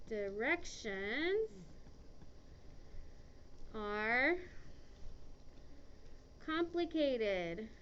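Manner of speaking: slow, drawn-out dictation, word by word. Shown as a demonstration. Teacher dictating to students, very slowly. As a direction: spell it out slowly for the class to copy. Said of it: "slow dictation".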